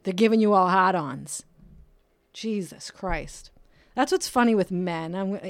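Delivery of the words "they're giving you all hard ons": ordinary talk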